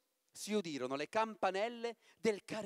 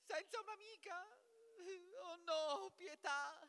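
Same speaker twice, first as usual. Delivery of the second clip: much higher, softer whimpering; mock crying plea; 12 semitones higher, 11 dB quieter than usual. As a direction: beg for mercy in hammed-up tears. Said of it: "disse Papageno". (supplica piagnucolosa, finta e acuta)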